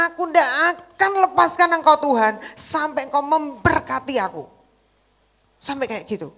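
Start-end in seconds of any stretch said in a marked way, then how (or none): 0.1-4.5 desperate prayer. Shown as a demonstration. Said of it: "saudara harus bilang gini".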